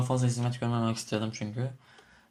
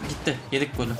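ordinary talk

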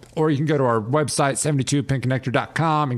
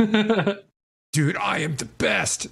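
high, boastful self-praise; smug cook voice; 7 semitones higher, much slower than usual. Pretend. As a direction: brag like a proud chef. Imagining bragging about own cooking.

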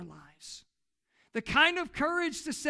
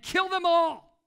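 louder, higher shouted command; a ruthless king's loud outburst; king's furious order; 6 semitones higher, 7 dB louder, slower than usual. Retold or imitated. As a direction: bark it as a royal death sentence. King's order, then repeated.

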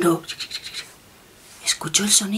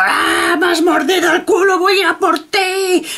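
higher, loud-ish hammed-up bear outrage; angry bear; 9 semitones higher, 3 dB louder than usual. Bear bitten on the bottom threatening the wolf, mock angry. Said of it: "muy nervioso y muy enfadado".

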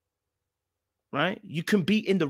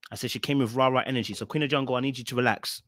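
normal talking voice

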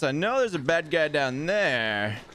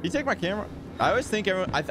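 ordinary delivery

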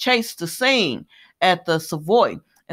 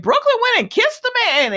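high, louder, shocked exclamation; scandalized; 4 dB louder than usual. Real, not acted. Telling viewers about the surprise kiss, shocked and high. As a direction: squeal it out like you can't believe it.

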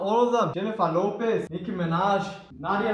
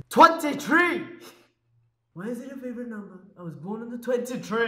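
put-on Jamaican accent; 4 semitones higher than usual. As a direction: exclaim it in a Jamaican accent, then ease off. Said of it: "do this in a Jamaican accent".

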